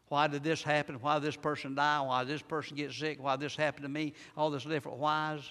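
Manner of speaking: ordinary talk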